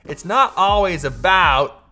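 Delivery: loud, slow Southern drawl; Southern US accent. Shown as a demonstration. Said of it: "I don't know why I went Southern".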